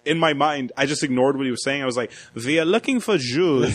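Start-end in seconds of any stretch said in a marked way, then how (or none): 2.3-3.7 put-on slow German-accented officer; mock German accent. Podcaster imagining an SS officer's search, German accent. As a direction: draw it out as a German interrogator. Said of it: "they remind me of, like, Nazis".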